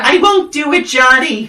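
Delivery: high, loud mock yell breaking into laughter; imitated yell. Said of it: "you hear her yell".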